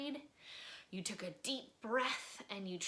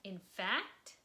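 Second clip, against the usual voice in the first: expressive read-aloud storytelling; lively children's book narrator; story narration; slower than usual. A narrator's reading. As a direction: read it like bedtime story time for kids. Reading a picture book aloud.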